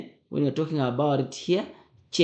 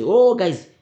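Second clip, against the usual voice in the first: quoting oneself; 6 semitones higher, 8 dB louder than usual. Retold or imitated. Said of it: "and then I always say".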